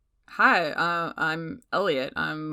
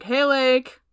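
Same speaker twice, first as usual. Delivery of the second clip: higher, louder hail; casual call-out; 7 semitones higher, 7 dB louder than usual. Acted. Elliot hailing a friend backstage, casual raised call. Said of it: "calls out".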